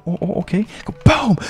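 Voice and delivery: usual speaking voice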